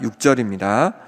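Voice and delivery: usual speaking voice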